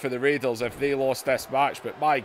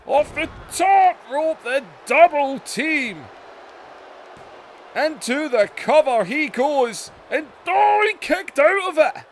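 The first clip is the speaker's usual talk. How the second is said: excited wrestling commentator; 11 semitones higher, 5 dB louder than usual. Real, not acted. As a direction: yell the near-fall like ringside commentary. Near-fall during live match call.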